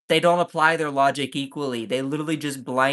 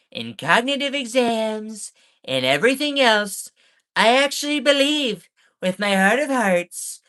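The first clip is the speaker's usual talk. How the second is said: Trump quote; 6 semitones higher, 4 dB louder than usual. Retold or imitated. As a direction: ham up the president's self-pity. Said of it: "he continues".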